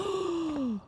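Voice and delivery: sharp high gasp; astonished gasp. Real, not acted. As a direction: gasp in sudden amazement. Sees the new trophy display.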